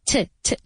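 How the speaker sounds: "T, t" as short tuh with a schwa; neutral vowel. Shown as a demonstration. Teacher isolating the first syllable of today, short "T, t". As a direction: keep "T, t" weak and short. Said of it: "se pronuncia como si fuera".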